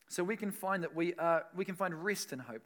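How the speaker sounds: usual speaking voice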